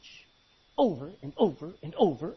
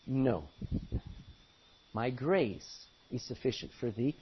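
low, measured voice of God; lightly voiced divine refusal; God's answer; 7 semitones lower, 6 dB quieter, slower than usual. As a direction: answer a prayer low and firm. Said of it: "he finally said back unto me".

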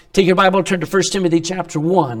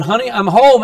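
cheery homecoming call; 5 semitones higher, 8 dB louder than usual. Retold or imitated. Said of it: "And he going".

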